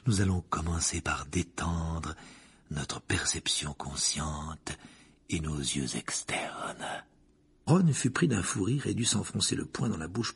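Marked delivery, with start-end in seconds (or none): 0.0-7.0 slow, low, hushed seer voice; mystic divination professor. A narrator's reading. Professor guiding the class into crystal gazing, slow and hushed. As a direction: draw out each phrase like a hypnotist.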